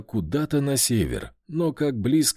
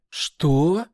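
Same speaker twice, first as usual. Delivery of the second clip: startled, higher-pitched exclamation; shocked; 4 semitones higher than usual. A narrator's reading. Pozharskaya hears the dog is an elemental, shocked. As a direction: blurt it out in astonishment.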